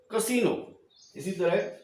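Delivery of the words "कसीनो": "कसीनो" repeated higher, middle vowel a long ee; casino with long ee, repeated. Shown as a demonstration. Teacher repeating the word for children, higher, long ee. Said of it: "इसको हम बोलेंगे कसीनो".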